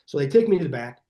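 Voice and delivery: normal talking voice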